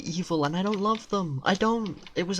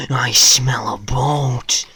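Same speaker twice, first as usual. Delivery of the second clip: slow, loud hissed whisper; hammed-up slow loud whisper; 3 semitones lower, 10 dB louder, much slower than usual. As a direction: whisper it greedily, slowly. Repeated in whisper.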